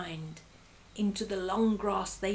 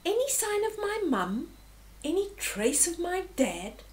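put-on high, pleading child's voice; small worried youngster's questions; anxious little warthog; 8 semitones higher than usual. A narrator's reading. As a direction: ask high, hoping for news. Little warthog asks anxiously after parents.

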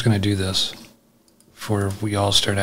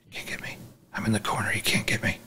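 1 whisper; 3 semitones lower, 11 dB quieter, faster than usual. Pretend. Hiding from monster, taunting it.

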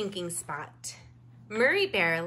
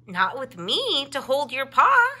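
firm and louder, reassuring; 4 semitones higher, 8 dB louder than usual. A narrator's reading. Sister bear firmly promising to hold her brother's paw. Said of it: "said Molly firmly".

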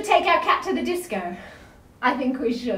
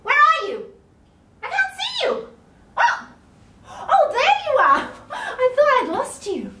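mock searching; 10 semitones higher than usual. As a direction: pretend you can't find them. Pretend searching with binoculars.

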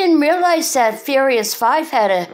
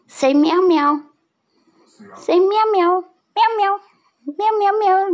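high squeaky mock meows; meowing to cat; 10 semitones higher than usual. Real, not acted. Coaxing her cat to meow, high mock meows.

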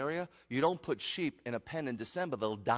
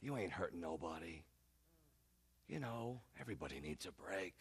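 very soft, low coaxing; hushed tempter's wheedling; cajoling enemy; 7 semitones lower, 11 dB quieter than usual. Acted. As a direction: sweet-talk them quietly into giving in. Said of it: "The enemy's always cajoling".

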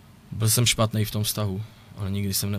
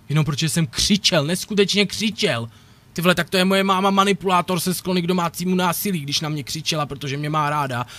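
higher and louder, an indignant yell; furious outburst; 6 semitones higher, 6 dB louder than usual. Real, not acted. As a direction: shout the injustice of being called an abuser. Furious rant about the accusation.

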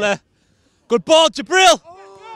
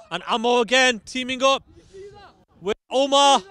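ordinary delivery

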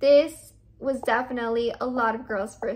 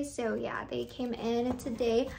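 normal talking voice

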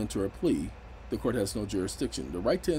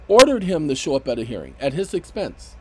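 louder emphatic repeat; 3 semitones higher, 9 dB louder than usual. Real, not acted. Repeated indignant point.

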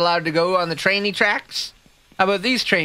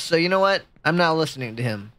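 ordinary delivery

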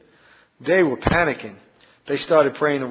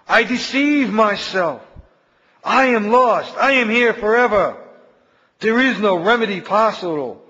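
desperate howling of lost souls; terrified cries; 6 semitones higher, 3 dB louder than usual. A narrator's reading. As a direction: cry out in despair like the damned. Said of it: "They cried out".

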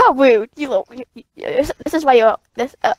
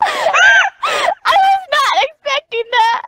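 breathless from laughing. Real, not acted. Player surprised by friend's skin switch, breathless high laughter. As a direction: gasp it out, still cracking up. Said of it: "I can't breathe".

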